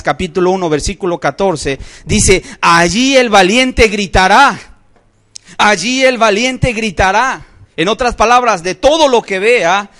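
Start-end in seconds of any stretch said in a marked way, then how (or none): 2.6-7.5 raised, forceful scripture reading; preached proclamation. Acted. Preacher thunders the verse to the congregation, loud.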